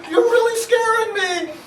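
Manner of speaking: scared whimper. Retold or imitated. Scared son tells his father to stop, whimpering. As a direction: whimper it like a frightened kid. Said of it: "He goes".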